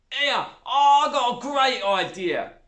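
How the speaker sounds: high, loud, slow stepmother voice; a coarse stepmother pleased with herself; stepmother's idea. A narrator's reading. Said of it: "said Zoe's stepmother".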